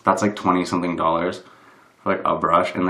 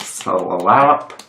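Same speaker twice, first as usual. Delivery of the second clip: drawn-out slap; 2 semitones lower than usual. Real, not acted. Patting on makeup, playful drawn-out slap. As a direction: stretch SLAP long with a rise.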